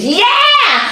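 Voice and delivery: put-on kids screaming yes. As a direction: cheer like kids who got their wish. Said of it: "the kids screamed".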